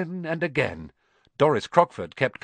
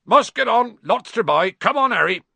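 loud, higher call; over the babble; 10 semitones higher, 7 dB louder than usual. Acted. Hagrid calls Harry out of busy pub, loud. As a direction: project over the crowd. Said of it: "make himself heard over the babble".

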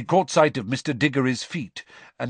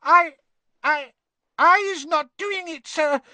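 very high squeaky elf, halting in terror; gasping frightened elf; 5 dB louder than usual. A narrator's reading. Winky pleading with Diggory, gasping in fear. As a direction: gasp it out in a tiny, panicked squeak. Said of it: "Winky gasped".

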